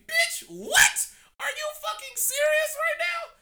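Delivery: high, loud outraged shout; outraged disbelief. Real, not acted. Outraged at the advice.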